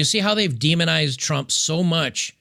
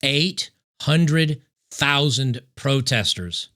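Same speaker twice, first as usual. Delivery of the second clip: slow, word by word, "hundred" leaned on; slowly; much slower than usual. Shown as a demonstration. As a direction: spell out every word at half speed. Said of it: "let me say that again slowly".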